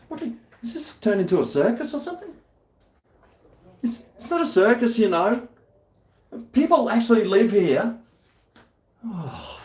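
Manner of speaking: high, fast indignant whine. Acted. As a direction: squeak it out, outraged. Indignant at sightseers.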